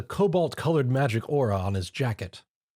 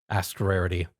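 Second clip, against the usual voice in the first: ordinary delivery, nothing marked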